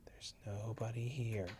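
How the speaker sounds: slow hushed whisper. Real, not acted. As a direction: whisper slowly, reassuring. Repeat whispered slowly.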